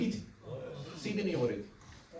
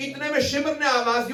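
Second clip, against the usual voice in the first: usual speaking voice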